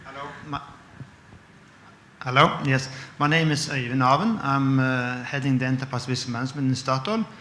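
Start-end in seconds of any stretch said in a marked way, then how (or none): none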